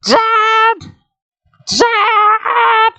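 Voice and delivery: put-on very high, loud girl's scream; high girl screaming for her dad; screaming at the top of her lungs. A narrator's reading. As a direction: scream as loud as she can. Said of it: "screamed to the top of her lungs".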